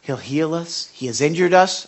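usual speaking voice

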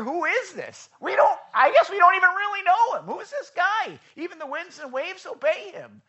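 high, loud awed disbelief; terrified awe. Retold or imitated. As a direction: disciples gasping at the calm sea. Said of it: "they were terrified".

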